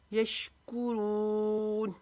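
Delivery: यशकुरून very slow, कू short, रून long; slow recited यशकुरून; tajweed recitation. Shown as a demonstration. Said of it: "रून को लंबा करेंगे".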